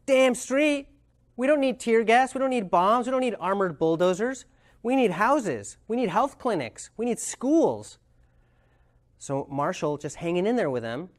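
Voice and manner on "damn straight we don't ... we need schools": heated venting. Retold or imitated. Angry man venting at Marshall about what they need. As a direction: pound out each need like a grievance. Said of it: "The guy's like".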